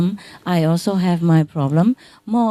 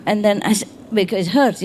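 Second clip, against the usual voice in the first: usual speaking voice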